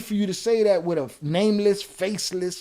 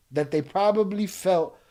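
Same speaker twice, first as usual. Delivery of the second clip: normal talking voice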